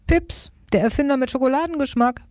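high-pitched, amused storybook teasing; giggly teasing. A narrator's reading. Jerry sees Pips covered in chocolate, amused high teasing. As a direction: tease a friend with a hint of giggle. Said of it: "kicherte Jerry".

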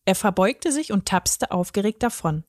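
ordinary delivery

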